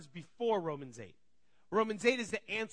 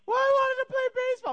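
put-on high, very loud childish protest; mock kid wail; 11 dB louder than usual. Kids protesting to their parent about baseball, mock wail. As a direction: imitate a kid wailing at full volume.

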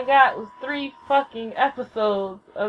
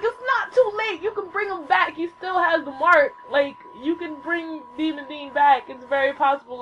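high, urgent protest easing down; reenacted fan begging the writers; insistent pleading; 5 semitones higher than usual. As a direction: argue hard that there's still hope. Retold pleading for Demon Dean's return.

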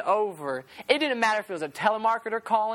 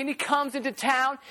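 ordinary talk

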